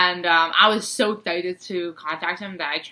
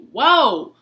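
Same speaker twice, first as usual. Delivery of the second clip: mock shock; 6 semitones higher, 6 dB louder, much slower than usual. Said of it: "I was like".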